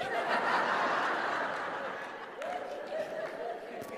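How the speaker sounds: high rising breathy laughter. Real, not acted. Pastor laughing at husband's reply, high breathy laugh.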